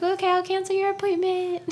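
high, falsely sweet customer-service voice; mock polite. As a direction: chirp it back, overly pleasant. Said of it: "I was like".

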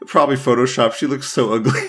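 loud, high laughter breaking up the words; cracking up. Real, not acted. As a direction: try to describe it while laughing hard. Laughing while describing a picture.

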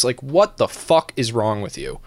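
ordinary talk

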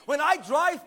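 preacher building the crowd; preaching at full force. Real, not acted. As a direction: keep the fire up.

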